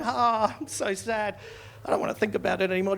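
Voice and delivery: ordinary talk, nothing marked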